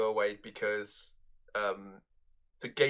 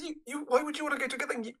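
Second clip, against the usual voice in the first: fast mock disbelief; 12 semitones higher, faster than usual. Randall mocking Dante, retold.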